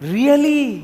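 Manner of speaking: high, loud, amazed "Really؟"; surprised. Retold or imitated. Friend hearing the engagement news, amazed. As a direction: jump up in pitch with disbelief.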